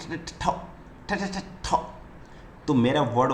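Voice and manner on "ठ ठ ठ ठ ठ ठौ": ठ repeated quick and stuck, then ठौ; fast stutter. Shown as a demonstration. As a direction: stammer on ठ fast and caught. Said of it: "अगर मैं इसको fast बोलूँ ठ". (तेज़ी से अटकता ठ ठ ठ)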